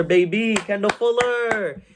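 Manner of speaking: celebrating a player coming home; hyped celebration. Real, not acted. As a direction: call it out like a touchdown. Celebrating Kendall Fuller's return.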